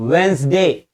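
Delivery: "वेंसडे" said close and clear, two syllables; model word. Shown as a demonstration. Teacher leaning in to model Wednesday for students. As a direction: give "वेंसडे" for them to repeat. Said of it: "बोलिए मेरे साथ".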